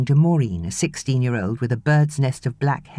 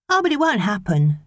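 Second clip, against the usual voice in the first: chirped; 8 semitones higher than usual. A narrator's reading. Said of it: "Maureen chirped".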